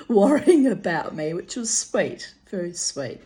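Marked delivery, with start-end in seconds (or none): 0.0-1.3 laughing. Real, not acted. Laughing remark.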